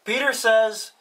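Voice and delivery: normal talking voice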